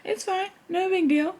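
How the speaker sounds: high, soft, forced calm; talking herself down in a thin high voice; mock self-reassurance. Strapped under the cage, telling herself it's fine in a high hushed voice. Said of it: "and I'm like".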